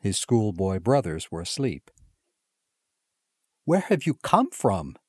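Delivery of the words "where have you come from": amazed cry. A narrator's reading. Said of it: "cried his parents in amazement".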